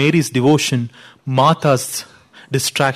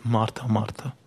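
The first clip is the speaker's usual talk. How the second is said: Martha Martha almost breathed, much softer and lower; a tender, hushed rebuke of a friend; gracious gentle rebuke; 8 semitones lower, 12 dB quieter than usual. Retold or imitated. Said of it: "A very gracious and a very gentle rebuke".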